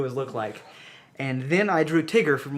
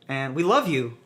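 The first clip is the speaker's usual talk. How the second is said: usual speaking voice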